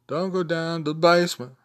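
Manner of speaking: high, half-sung lyric quote. Retold or imitated. Fan quoting a favourite singer's lines, high sing-song imitation. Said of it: "love his inflection and the way he phrases things".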